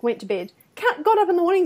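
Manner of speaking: normal talking voice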